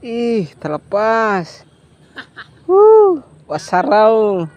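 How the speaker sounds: drawn-out, high cry as the fish gets loose; loud, high-pitched whoop of dismay; excited cry. Real, not acted. Angler reacts as the catch slips loose, excited cry.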